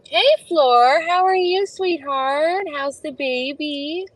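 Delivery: high, sing-song greeting to a viewer; high, affectionate hello; sweet greeting. Real, not acted. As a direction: lift the voice up sweetly for a friend.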